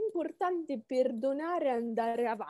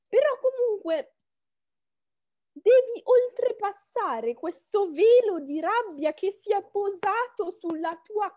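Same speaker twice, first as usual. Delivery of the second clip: loud, high rousing encouragement; rally him with passion; fired-up pep talk; 7 semitones higher, 4 dB louder than usual. Acted. Passionate pep talk to heartbroken guy.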